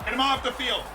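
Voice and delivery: high, indignant call to the ref; worked-up fan appealing to the referee; mock outraged demand. Demanding the ref send player off.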